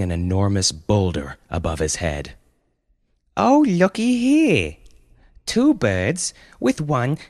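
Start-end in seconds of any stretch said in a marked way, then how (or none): 3.4-7.2 put-on high, drawn-out gloating child voice ending in a cackle; taunting eight-year-old boy. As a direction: play a gleeful little villain kid, slowly. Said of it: "said the eight-year-old boy".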